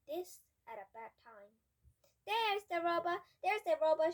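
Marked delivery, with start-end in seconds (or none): 2.3-4.1 loud, high call; shout. Acted. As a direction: shout it out as you spot him. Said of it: "shouted the woman in the green jacket".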